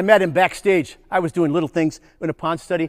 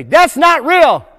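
slow, loud, high shout; 7 semitones higher, 5 dB louder, much slower than usual. Shown as a demonstration. Presenter shouting a slow protest for the kids. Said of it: "Everybody say".

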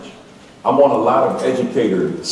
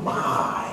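"my" drawn out softly; hushed, lingering emphasis on "my"; stress on "my"; 7 dB quieter than usual. Shown as a demonstration. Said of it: "I put that emphasis on this word".

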